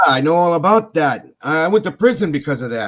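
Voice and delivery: put-on old coworker admitting the prison time, high and loud; old man's voice. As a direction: blurt it out like an old man.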